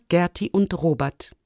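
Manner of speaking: plain reading voice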